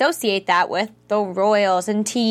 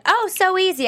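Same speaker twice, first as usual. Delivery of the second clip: higher-pitched, breezy imitation; breezy mock quote; 10 semitones higher than usual. Retelling how he made it seem simple, breezy mock quote. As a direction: toss it off high, as if effortless. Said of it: "He made it seem like".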